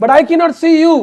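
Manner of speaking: loud, high-pitched acted protest; emphatic outburst. Mantra protesting to Kaikeyi about her becoming servant, emphatic outburst.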